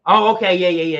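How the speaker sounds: loud, suddenly eager mock reply; excited. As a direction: burst into excited recognition, acting it out. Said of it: "then got excited".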